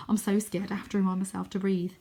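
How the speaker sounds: ordinary delivery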